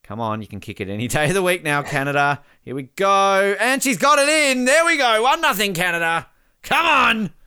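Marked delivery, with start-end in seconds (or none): 2.6-7.3 high, loud goal call; triumphant commentary. Real, not acted. Fan calling Canada's successful penalty, triumphant. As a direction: cheer the score in, loud and high.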